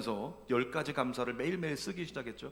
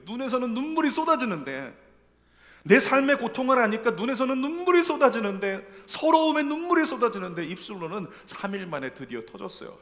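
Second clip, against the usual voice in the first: raised, louder preaching voice; fervent preaching; 8 semitones higher, 9 dB louder than usual. Acted. Pastor urging the congregation, fervent preaching. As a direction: drive the point home to the pews.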